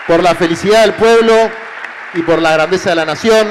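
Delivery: high, loud rally oratory; booming balcony speech. Real, not acted. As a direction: build to a roaring crowd-rousing close. Rally speech climax to crowd.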